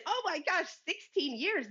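delighted disbelief at the news; amazed. Retold or imitated. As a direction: pitch jumps high on oh my gosh. Amazed reply to sixteen years.

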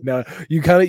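ordinary talk, nothing marked

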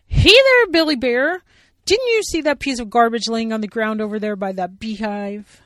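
high, loud cheerful bear voice; a big, bright puppet voice hailing a friend; Sally Bear voice. Acted. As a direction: call out brightly as a bossy bear.

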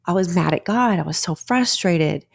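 usual speaking voice

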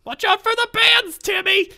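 very high, loud mock-frantic mother; panicked mom. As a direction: shriek a warning at the kid. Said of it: "They're just like".

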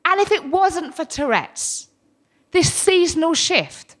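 usual speaking voice

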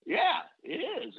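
put-on child's eager agreement, pitched high. Small grandson eagerly answering grandma in a high childlike voice. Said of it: "And I go".

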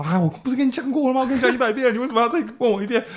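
high, fast nagging mother; nagging mom lecture. Retold or imitated. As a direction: scold like an exasperated mom. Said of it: "然后我妈就对我唠叨啊".

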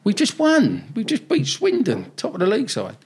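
high, elated cheer; imitating buzzing players after a win; excited players. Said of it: "players are like".

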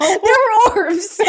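high insistent pleading. Retold or imitated. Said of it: "insisting on orbs".

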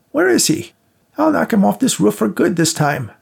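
put-on high, loud old woman threat; frantic old woman. A narrator's reading. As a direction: snarl it, searching wildly. Rose hunting the ghost.

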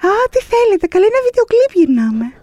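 high, squeaky mock-innocent voice; high sing-song feigned innocence, calling out to armed police; playing the innocent. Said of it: "αρχίζω και φωνάζω".

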